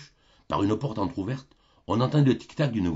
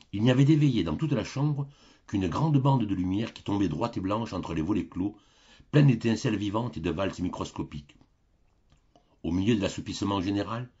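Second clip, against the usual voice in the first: usual speaking voice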